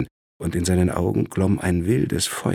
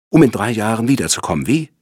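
fast, higher, louder comeback; scornful retort; 5 semitones higher, 7 dB louder, faster than usual. A narrator's reading. Lawyer doubts his brother will stay away, scornful. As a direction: fire the question straight back.

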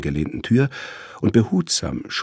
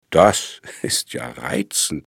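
sarcastic; slower than usual. A narrator's reading. Brother sarcastic at being declared dead.

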